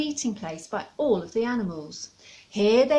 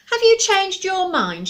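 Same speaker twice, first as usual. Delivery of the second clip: much higher, louder question; challenging owl; 9 semitones higher, 9 dB louder than usual. Acted. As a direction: demand an answer.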